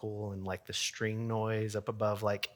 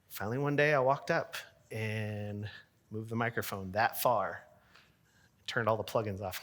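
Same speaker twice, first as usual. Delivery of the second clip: ordinary talk, nothing marked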